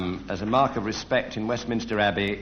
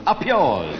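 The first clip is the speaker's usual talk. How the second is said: mock shout; 3 semitones higher, 6 dB louder than usual. Shown as a demonstration. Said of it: "shout to the barman".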